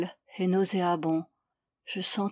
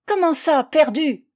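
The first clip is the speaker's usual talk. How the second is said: loud, high incredulous challenge; angry disbelief; 7 semitones higher, 7 dB louder than usual. A narrator's reading. Girl questions the accused woman, angrily incredulous. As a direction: throw the word back as a challenge.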